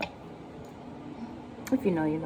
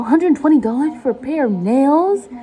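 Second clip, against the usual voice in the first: louder, higher, drawn-out disbelief; incredulous outrage; 5 semitones higher, 5 dB louder, slower than usual. Acted. Customer balking at nail salon prices, loudly incredulous. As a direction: balk at the price, stretching the words.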